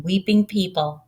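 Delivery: ordinary delivery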